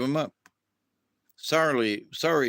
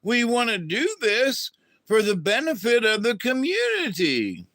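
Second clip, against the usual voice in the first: indignant Northerners; 7 semitones higher than usual. Retold or imitated. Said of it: "some of these people were like".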